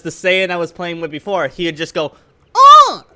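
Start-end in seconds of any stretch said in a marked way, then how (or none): 2.5-3.0 very high, loud yelp; imitated character yelp. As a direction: mimic a game character's piercing cry. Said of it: "That's the noise he would make".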